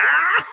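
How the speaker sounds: high, loud burst of laughter; hammed-up cackle from on high; mock divine laugh. God laughs from above at the tenant, mock divine laugh. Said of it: "creo que Dios de arriba decía".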